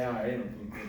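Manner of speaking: ordinary talk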